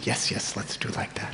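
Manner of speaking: eager hushed whisper; whispered boys agreeing. Acted. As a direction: breathe it out, secret and eager.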